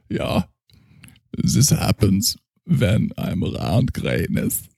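put-on slow, savouring German accent; a drunk German doctor praising his schnapps; heavy German accent, tipsy. Character continues.

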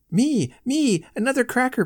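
put-on high, eager little child begging; eager child's cry. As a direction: beg like an excited small child. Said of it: "That was the cry".

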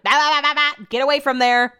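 loud, high warning to a dog; urgent mock-yell at the dog; retold shooing. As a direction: shout rapid noises to shoo a puppy off.

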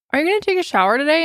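quick, lightly voiced boyfriend nagging; imitating boyfriend. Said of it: "he was like".

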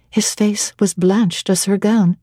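usual speaking voice

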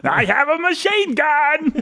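high, slow, hammed-up villain impression; Alan Rickman. Co-host mocking the other's Rickman, high slow impression. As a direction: stretch it into a silly movie-villain send-up. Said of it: "Is that how I do Rickman?".

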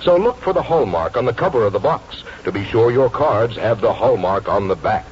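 usual speaking voice

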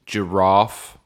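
"giraffe" with a long British "ah"; British pronunciation. Shown as a demonstration. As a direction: say "giraffe" as ji-RAHF. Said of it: "confused by my pronunciation".

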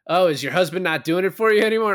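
mocking taunt. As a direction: needle him with a smirk. Tom Arnold taunting in interrogation.

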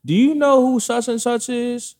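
high-pitched retold question to mom; high phone-call question, acted out; asking mama.

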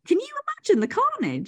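excited. Real, not acted. Imagining kids and Henry.

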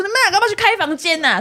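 high, fast mock scolding; teasing scold. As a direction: snap it out teasingly. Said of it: "小S就最后骂说".

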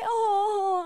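mock moan. Friend mimics fake moans.

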